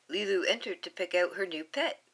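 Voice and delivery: plain reading voice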